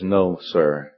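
low-pitched, modest answer; humble reply. Acted. Acting Carey's humble reply.

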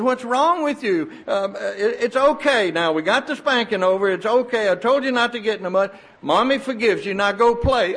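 high, insistent mock-motherly reassurance; exasperated mother. Mother tells crying boy spanking is over, exasperated and high. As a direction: impatiently coax a child, pitched well up.

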